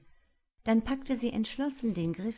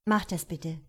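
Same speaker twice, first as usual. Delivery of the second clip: short, mildly curt request; 4 dB louder than usual. A narrator's reading. Detective answers her colleague's offer, curtly. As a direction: answer briefly, a touch curt. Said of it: "antwortete sie knapp".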